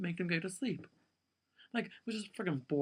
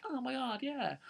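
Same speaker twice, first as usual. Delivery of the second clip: high, breathless mock gush with a sudden squeal; hammed-up imitation of giddy friends; 10 semitones higher than usual. Mocking gushing hospital friends.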